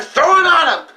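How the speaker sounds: high taunting yell; mocking yell. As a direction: jeer it like a thug hyping the crew. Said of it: "the leader of that fucking group is like".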